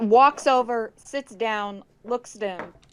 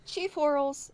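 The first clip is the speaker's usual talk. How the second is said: soft, higher-pitched controlled monologue; very calm voice; 6 semitones higher, 6 dB quieter than usual. Acted. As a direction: address the chief quietly, with composure. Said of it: "in a very calm voice".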